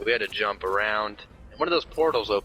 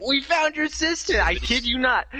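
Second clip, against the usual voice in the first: much higher, eager announcement; excited; 12 semitones higher than usual. Retold or imitated. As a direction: blurt out the big news. Announcing to Brian.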